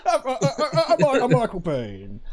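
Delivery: high, loud comic impression breaking with laughter; silly put-on voice, starting high and dropping; Steve Coogan as Michael Biehn. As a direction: stammer into a hammed-up name announcement. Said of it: "Steve Coogan as Michael Biehn".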